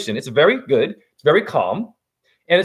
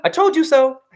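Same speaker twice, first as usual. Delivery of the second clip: smug told you so; 9 semitones higher than usual. Pretend. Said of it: "And I'ma tell you".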